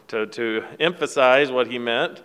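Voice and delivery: usual speaking voice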